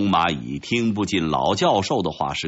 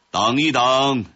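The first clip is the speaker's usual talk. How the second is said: higher-pitched commanding interjection; calling out a halt; 5 semitones higher, 3 dB louder than usual. Acted. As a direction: call from a distance to interrupt. Newcomer calls out to halt execution.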